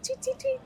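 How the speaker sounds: tiny high-pitched birdlike chirps; high bird tweeting. Shown as a demonstration. Comparing Japanese to birds speaking, high tweeting. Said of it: "like, birds speaking".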